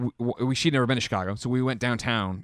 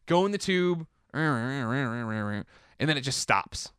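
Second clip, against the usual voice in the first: ordinary talk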